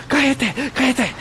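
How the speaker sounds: imitating a lady. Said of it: "a lady like".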